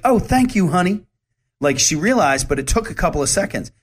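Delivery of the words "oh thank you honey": high, louder delighted thank-you; woman thanking him once she sees his smile; sweet thanks. Retold or imitated. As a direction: gush it warmly, much higher. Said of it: "she's like".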